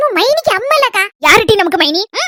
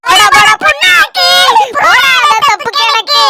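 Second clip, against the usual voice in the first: mocking retort; 6 semitones higher, 4 dB louder, slower than usual. Teasing retort to mocking friend.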